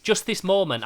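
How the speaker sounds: ordinary talk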